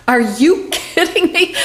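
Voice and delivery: high, slow, laughing "are you kidding me"; laughing disbelief. Retold or imitated. To herself as the car starts, a laughing high disbelief. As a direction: replay the moment with a disbelieving laugh.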